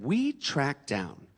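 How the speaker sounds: slow, drawn-out solemn host; Henry Louis Gates. Retold or imitated. Host revealing ancestry to the comic, slow and solemn. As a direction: lean in, slow and portentous, pausing. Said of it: "Henry Louis Gates looked at me and said".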